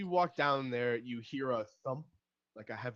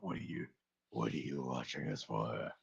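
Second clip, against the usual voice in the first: very deep superhero growl; Batman; 12 semitones lower than usual. Acted. GM as Chris turned vigilante, interrogating, deep growl. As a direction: drop way down for a menacing interrogation. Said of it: "he's turned into Batman for this".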